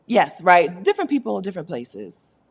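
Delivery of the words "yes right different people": ordinary talk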